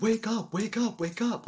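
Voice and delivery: high, loud pleading call; desperate inner shout; desperate wake-up. Retold or imitated. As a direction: shake yourself awake with your voice. Trying to shake himself awake.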